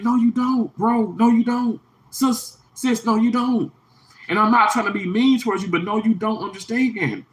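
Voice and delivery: high-pitched, insistent pushback; exasperated retort. Retold or imitated. Telling off people who claim to understand, exasperated.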